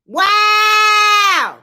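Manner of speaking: high, loud shouted wow; exclaimed wow. Real, not acted. Reacting to her own point, a high loud shout. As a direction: throw it out to the room. Preaching climax.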